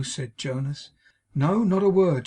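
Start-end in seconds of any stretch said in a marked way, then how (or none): 1.4-2.3 quick, louder, anxious reply; hurried nervous denial. A narrator's reading. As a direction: answer fast to calm him. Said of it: "she answered quickly".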